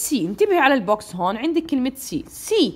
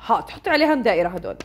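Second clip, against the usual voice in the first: usual speaking voice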